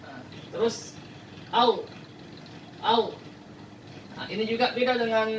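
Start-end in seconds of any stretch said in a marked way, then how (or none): 1.5-3.1 "al" said as if swallowing; swallowed Arabic letter; swallowed sound. Shown as a demonstration. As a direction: gulp the "al" from the throat. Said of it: "seperti menelan ya".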